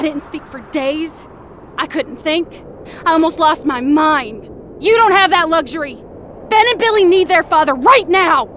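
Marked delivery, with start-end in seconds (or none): none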